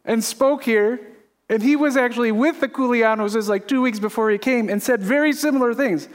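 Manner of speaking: usual speaking voice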